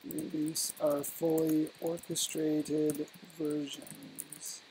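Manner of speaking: very slow and soft, long gaps between phrases; halting, hushed, word-by-word dictation. Real, not acted. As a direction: murmur it piece by piece as you write it down. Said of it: "you're speaking too slow".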